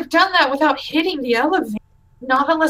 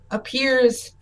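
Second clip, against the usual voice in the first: ordinary talk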